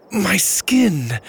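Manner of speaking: high-pitched, acted cry of pain; pained groan. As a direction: cry out as the skin stings. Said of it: "Mora started to groan as well".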